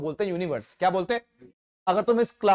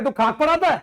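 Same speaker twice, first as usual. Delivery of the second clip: raised, higher scolding dad; a hint of a scolding father; mock scolding father; 7 semitones higher, 5 dB louder than usual. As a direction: throw it like an irritated father. Father scolding in retold story.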